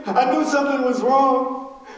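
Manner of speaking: loud, high preacher's fervour; preaching at full force. Real, not acted. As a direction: drive the memory home from the pulpit.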